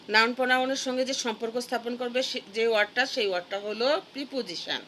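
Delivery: ordinary talk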